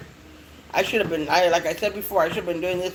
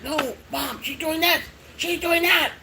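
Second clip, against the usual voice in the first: put-on complaining kids; 9 semitones higher than usual. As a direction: imitate kids telling on the puppy to Mom. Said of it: "they'll be like".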